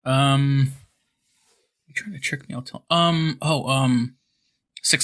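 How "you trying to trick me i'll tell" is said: put-on hushed, much softer child voice; softer kid voice. A player acting a child, hushed and soft. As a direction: whisper it like a scared little kid. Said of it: "like a kid is saying it".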